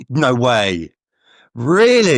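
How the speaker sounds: drawn-out, astonished reaction; real disbelief. Real, not acted. Host learns the guest is the same age, astonished.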